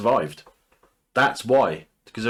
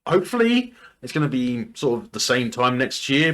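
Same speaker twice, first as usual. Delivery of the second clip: usual speaking voice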